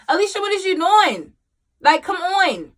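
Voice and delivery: high, exasperated reproach; incredulous self-scolding. Retold or imitated. Mom telling herself to get up, exasperated. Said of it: "in my mind, it was just like".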